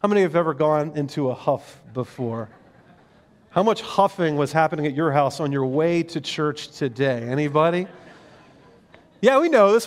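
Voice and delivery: usual speaking voice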